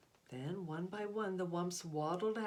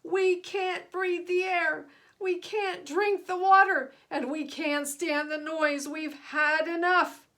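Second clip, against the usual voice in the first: loud, higher, slower furious chant; angry crowd shouting; 8 semitones higher, 10 dB louder than usual. A narrator's reading. Pollutions shouting at the chief, loud and furious. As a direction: shout it up at the balcony. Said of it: "all the pollutions began shouting at once".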